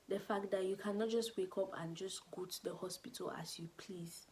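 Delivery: normal talking voice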